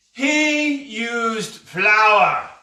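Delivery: high, loud, very drawn-out lament; appalled disbelief. A narrator's reading. Pirate complaining to the ceiling about the boy, appalled. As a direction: stretch each word out to the heavens. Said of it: "he said to the ceiling".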